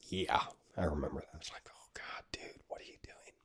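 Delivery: near-silent whisper; hushed regret. Retold or imitated. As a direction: whisper it, cringing at your own blunder. Cringing at his own honeymoon blunder.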